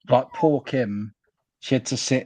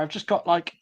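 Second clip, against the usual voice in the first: usual speaking voice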